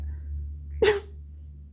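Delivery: imitated sneeze, high and airy; a cat-sized sneeze from inside the vent; high breathy sneeze. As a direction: let out a squeaky little fake sneeze. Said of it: "And I sneezed".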